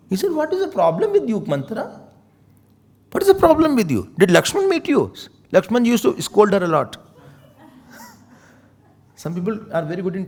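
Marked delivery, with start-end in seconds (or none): 0.3-5.1 high, sharp scolding; annoyed rebuke. Acted. Kekai rebukes Manthara's talk, high and sharp. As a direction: snap at the servant.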